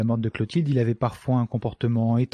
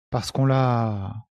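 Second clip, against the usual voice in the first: slow, dragged-out, unfinished sentence; words failing; much slower than usual. A narrator's reading. Shocked Célestin cannot tell the café, halting. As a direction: stall on the last word, unable to finish. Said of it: "Les mots refusaient toujours de sortir".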